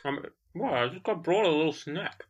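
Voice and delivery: chewing while answering; mouth full. Real, not acted. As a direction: speak through a mouthful of bread. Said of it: "Sorry, I'm still eating".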